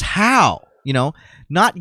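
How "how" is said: higher, punchy "How?"; mild retold bullhorn challenge; pointed question. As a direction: fire the one word back. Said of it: "He goes".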